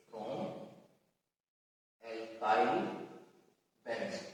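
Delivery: low, soft, one word at a time; writing aloud. Real, not acted. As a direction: murmur each word slowly as you write, long gaps. Repeats it while writing it down.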